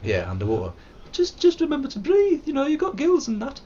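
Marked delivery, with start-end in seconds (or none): 1.1-3.6 put-on high, jokey northern English accent. Streamer mimics chat advice in a high mock northern accent. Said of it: "stupid northern accent".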